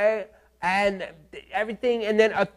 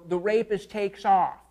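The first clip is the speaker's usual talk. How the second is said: ordinary delivery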